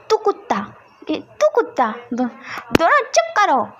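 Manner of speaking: high, sharp order to stop; scolding. A narrator's reading. Madam scolding the two boys, high sharp scold.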